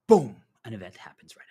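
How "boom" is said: explosive loud burst on one word; sudden boom. Acted. Teacher springing a sudden event on the listeners, explosive boom. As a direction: punch it out like a blast.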